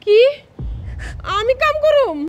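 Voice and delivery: high-pitched, affronted question; indignant disbelief. Acted. Daughter is told to do chores, indignant and incredulous. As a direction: balk at the very idea. Ordered to do housework.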